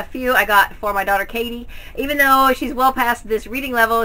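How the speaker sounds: ordinary talk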